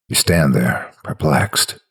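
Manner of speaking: usual speaking voice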